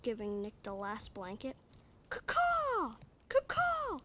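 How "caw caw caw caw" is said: high squawking bird call, kaw kaw; hawk cry. Acted. Child reader squawks the mama hawk's call. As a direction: screech it like a hawk.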